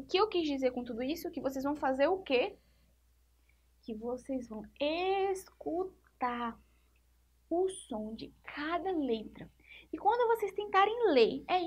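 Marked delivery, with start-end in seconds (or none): 3.8-9.5 soft near-whisper, slow, leaning in; hushed emphasis. Acted. Teacher hushing to pupils to stress how to read. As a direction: draw the pupils close with a hush.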